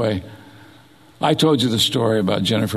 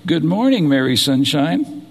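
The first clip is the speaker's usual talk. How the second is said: lilting, higher sing-song wake-up call; 4 semitones higher than usual. Retold or imitated. Wife waking her daughters each morning, in a sing-song voice. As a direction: imitate a mother's sing-song morning greeting. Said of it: "in this little sing-song voice".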